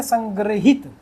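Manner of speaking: संग्रहित as "sang-gri-hit"; correct pronunciation. Shown as a demonstration. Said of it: "इसको पढ़ेंगे".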